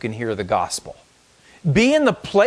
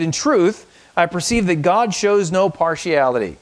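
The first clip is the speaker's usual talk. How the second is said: raised, proclaiming scripture voice; scripture reading; 4 semitones higher than usual. Acted. Pastor reading scripture aloud to the congregation, proclaiming. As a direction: read the verse out as a proclamation.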